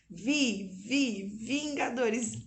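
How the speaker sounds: slow, syllable by syllable Vi, vi, Vingadores; sounding out. Shown as a demonstration. Mother modelling the first syllable for her son, slowly. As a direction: stretch Vi so the child hears the first sound.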